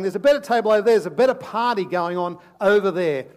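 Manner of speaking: loud, coaxing tempter voice; tempting enemy. Acted. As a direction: lure them loudly toward the other table. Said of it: "he's saying".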